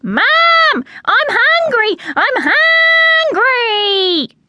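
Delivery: shrieking chick begging its mother; very high, loud, drawn-out squeal; screech. A narrator's reading. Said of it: "she screeched".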